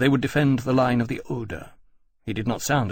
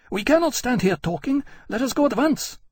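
urgent queen. A narrator's reading. Queen insisting.

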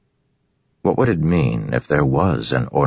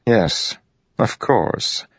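mild, higher-pitched amusement; amused assent, only a hint of chuckle; chuckling; 8 semitones higher, much slower than usual. A narrator's reading. Royan chuckles at Dalinar's answer, lightly amused. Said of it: "Royan said, chuckling".

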